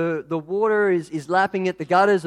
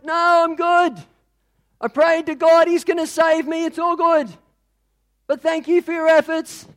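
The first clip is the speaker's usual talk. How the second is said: high, loud, cheerful shout upward; man on roof shouts his refusal up to the helicopter; shouting over noise; 10 semitones higher, 5 dB louder than usual. Acted. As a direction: yell up over the rotors.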